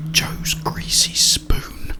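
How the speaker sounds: ordinary delivery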